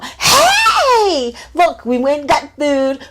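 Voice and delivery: high, loud laughing greeting; bubbly drunk hello on the phone; giddy drunk friend. Retold or imitated. Drunk friend answering the phone late at night, high loud giddy.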